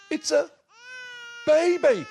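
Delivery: high, loud disbelieving reveal; astonished. Acted. Bill telling himself what's in the box, high and amazed. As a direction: land the word with wonder.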